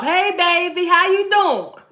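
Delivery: high, loud, sing-song mock greeting; flirty wife greeting. Wife popping up at husband's job, high playful greeting. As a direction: play the sweet wife surprising her man.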